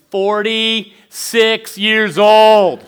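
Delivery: loud, very slow, drawn-out punchline; comic disbelief. Real, not acted. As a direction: stretch each word in incredulous amazement. Incredulous punchline about brother's age.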